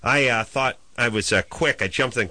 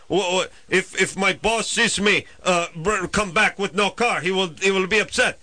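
mimicking the tow driver; 6 semitones higher than usual. Tow driver quoted in story.

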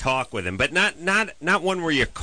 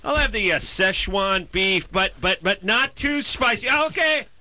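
much higher, fussy ordering voice; mock customer ordering; 11 semitones higher than usual. Caller anxiously ordering Thai food, voice pitched up high. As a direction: keep insisting it's not too spicy, pitched up high. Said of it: "you ring the police department".

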